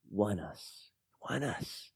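whispered, repeated softly. Acted. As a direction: breathe the words in awe. Hushed awe at salvation.

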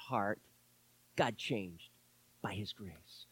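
very soft, low, awed near-whisper; hushed reverence. Real, not acted. Preacher testifying how grace changed his heart, hushed. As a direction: drop low, fading to a whisper.

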